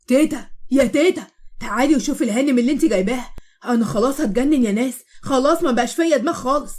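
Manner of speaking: calling out. Acted. Moatasem calling grandma loudly to complain. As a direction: shout across the house for help.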